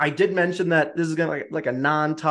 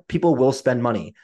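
ordinary talk

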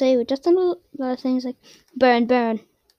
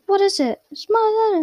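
put-on raised childish demand; a hint of a whiny boy; grabby kid voice; Dudley's claim; 8 semitones higher than usual. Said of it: "then he's like".

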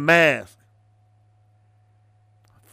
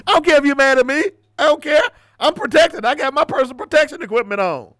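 defiant taunt; 13 semitones higher, 5 dB louder, faster than usual. Real, not acted. Defiant taunt to angry listeners.

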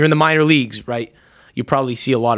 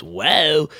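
imitating his wow; 3 semitones higher than usual. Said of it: "where he goes".

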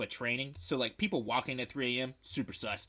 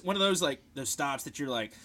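ordinary delivery

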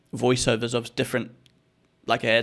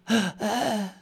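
mock gasp; 10 semitones higher, 4 dB quieter than usual. Reacting to the ad voice.

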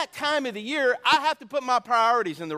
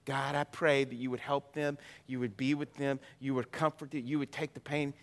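soft prayer; 7 semitones lower, 7 dB quieter than usual. Acted. Pastor prays over the children.

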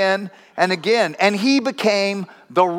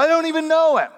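much higher, pleading denial; panicked high denial; 9 semitones higher, faster than usual. Retold or imitated. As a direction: blurt it out high and scared. Peter denies again.

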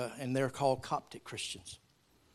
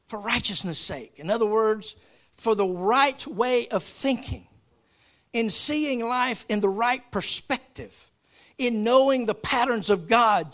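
loud, high emphatic preaching; full-force preaching; 7 semitones higher, 6 dB louder than usual. Real, not acted. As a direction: drive the point home to the church.

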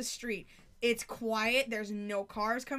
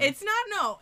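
defensive rush; 9 semitones higher, 8 dB louder than usual. Real, not acted. Quick defensive backpedal.

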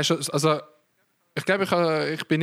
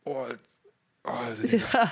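shocked. Real, not acted. Shocked at search results.

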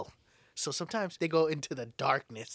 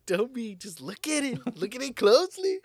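teasing insinuation; 9 semitones higher, 5 dB louder than usual. Acted. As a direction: tease him louder each time. Teasing repeat.

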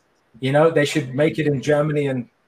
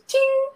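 sound effect. Pretend. Imitated cash or bell sound.

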